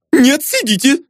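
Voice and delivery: high, squealing protest; crying out. A narrator's reading. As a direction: squeal the order in a panicky falsetto. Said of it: "закричал Изнурёнков".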